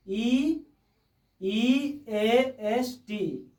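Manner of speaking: "ई ए एस टी" spelled letter by letter, very slowly; slow letter-by-letter spelling. Shown as a demonstration. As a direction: spell "ई ए एस टी" out one letter at a time, drawn out. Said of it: "east".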